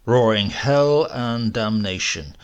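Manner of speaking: usual speaking voice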